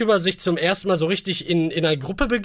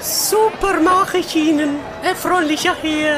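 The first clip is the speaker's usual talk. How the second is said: put-on waitress voice; 9 semitones higher than usual. Mulled wine seller greets her customer in a high put-on voice. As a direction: play a sweet stall waitress, pitched high. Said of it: "Sie klingen wie Uta von den Simpsons".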